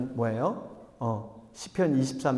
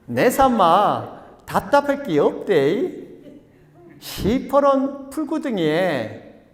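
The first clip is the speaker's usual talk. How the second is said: loud, slow, swooping Gyeongsang lilt; hammed-up Gyeongsang scripture reading; Gyeongsang dialect; 7 semitones higher, 6 dB louder, slower than usual. Pastor reading the psalm's Gyeongsang version, hammed-up dialect. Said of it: "경상도 버전으로 이렇게 얘기한다고 합니다".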